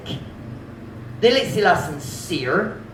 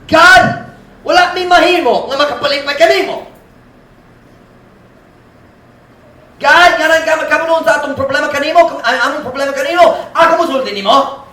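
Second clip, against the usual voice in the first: high, loud resentful accusation; resentful complaint; 8 semitones higher, 6 dB louder than usual. Acted. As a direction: accuse God of being too strict. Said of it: "That attitude says".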